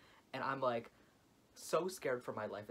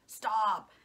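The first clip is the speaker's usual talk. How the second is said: a much higher, much louder imitated yell; retold angry shout; 10 semitones higher, 12 dB louder than usual. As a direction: bark it like the furious examiner. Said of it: "he gets so angry, he's like".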